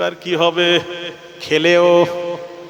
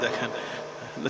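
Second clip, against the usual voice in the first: whispered aside; 8 semitones lower, 16 dB quieter, much slower than usual. Real, not acted. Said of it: "preacher aside".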